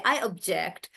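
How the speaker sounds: second syllable of object carries the weight; stress on JECT. Shown as a demonstration. Teacher voicing a student's objection, stress on JECT in object. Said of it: "So that's the verb".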